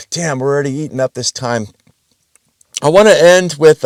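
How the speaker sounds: ordinary talk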